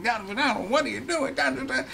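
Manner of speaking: mock yelling women. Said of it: "these women are yelling".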